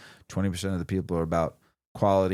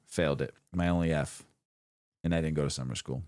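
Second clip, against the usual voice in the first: normal talking voice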